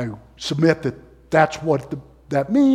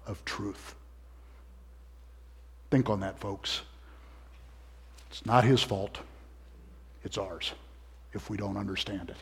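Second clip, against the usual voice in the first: much softer and lower; hushed; 8 semitones lower, 16 dB quieter than usual. Real, not acted. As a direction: drop to a quiet, sincere hush. Hushed after loud quote.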